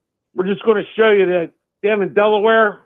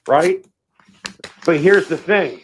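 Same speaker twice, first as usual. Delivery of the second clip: normal talking voice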